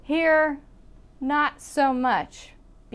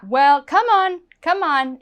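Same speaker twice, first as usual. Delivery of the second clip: higher, upbeat "come on"; let's-go invitation; 4 semitones higher, 3 dB louder than usual. Shown as a demonstration. Teacher shows it means let's go, friendly urging. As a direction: urge someone to walk along with you. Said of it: "It means let's go".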